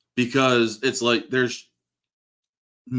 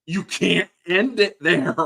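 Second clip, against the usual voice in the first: drawn-out, higher, pleading protest at the screen; exasperated disbelief; 8 semitones higher, 4 dB louder than usual. Retold or imitated. Fan protesting the movie ending, slow exasperated plea. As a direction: stretch it out, begging the film not to stop. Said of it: "and I'm like".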